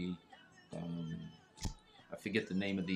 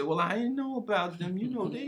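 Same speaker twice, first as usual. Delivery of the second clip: excuse-maker voice; 12 semitones higher than usual. Retold or imitated. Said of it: "so you can't say".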